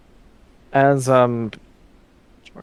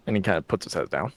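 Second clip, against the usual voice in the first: ordinary delivery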